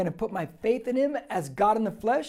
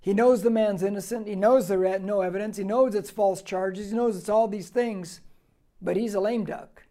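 ordinary talk

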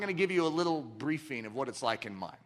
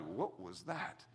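stern Washington; 10 semitones lower, 13 dB quieter, much slower than usual. Retold or imitated. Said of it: "turns around like".

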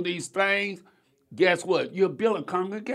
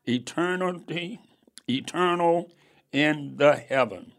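measured, slightly louder Bible reading; scripture reading; 3 semitones lower, 3 dB louder than usual. Acted. Preacher reading Corinthians to the congregation, measured. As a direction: read the verse with weight. Reads Second Corinthians aloud.

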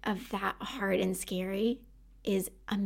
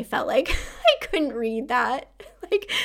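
laughing; 3 semitones higher, 4 dB louder than usual. Real, not acted. Laughs at herself.

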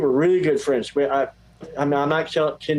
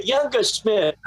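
higher-pitched imitated English accent; 7 semitones higher, slower than usual. English producer calling the younger brother, imitated English accent. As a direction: mimic an Englishman calling a name. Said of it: "in his English accent".

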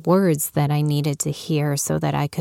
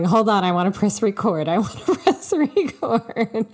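higher-pitched, rushed, laughing plea; eager interjection; 6 semitones higher than usual. Retold or imitated. Host retells interrupting her guest before recording, eager and laughing. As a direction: blurt it quickly, pitch climbing into a laugh. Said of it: "I kept going".

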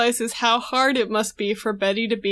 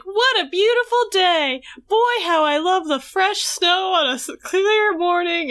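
higher, sing-out cheeriness; Betty's chipper act; 6 semitones higher than usual. Retold or imitated. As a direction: gush with hammed-up delight. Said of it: "whole thing where she's just chipper".

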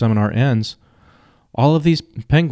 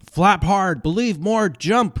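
high pushy coach voice; life coach; 8 semitones higher, slower than usual. Retold or imitated. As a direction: drive them on, pitched high. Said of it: "he says".

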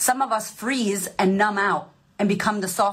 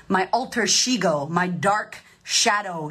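ordinary talk, nothing marked

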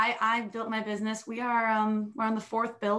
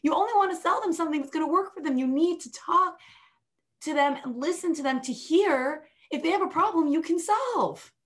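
emphatic retort; 7 semitones higher than usual. Retold or imitated. Coach correcting a client who wants to sell, raised and emphatic. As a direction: set the client straight firmly. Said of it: "I said".

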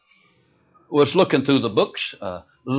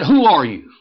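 angry; 5 semitones higher, 8 dB louder than usual. Retold or imitated. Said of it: "Boy, she was getting angry".

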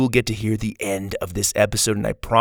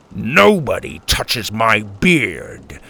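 put-on slow, louder old man grumble; grumbling grandpa; 4 dB louder, much slower than usual. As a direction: growl it out, very slowly. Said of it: "Grandpa grumbled".

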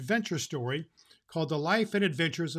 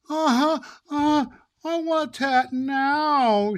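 put-on much higher, louder, slow bawling little girl; wailing child; 11 semitones higher, 8 dB louder, much slower than usual. Little Mary told to wait for her cat, wailing. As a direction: wail it like a disappointed toddler. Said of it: "she wailed".

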